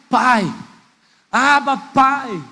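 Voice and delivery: slow, drawn-out call to the Father; mild cry from the pulpit, stretched out. Acted. Preacher voices the Spirit's cry within believers, slow calling out. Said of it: "esse grito é".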